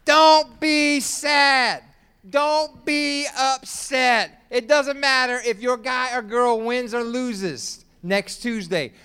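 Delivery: loud, high, hammering each don't; full-force preaching. Acted. Pastor exhorts the congregation not to be sad, full-force preaching.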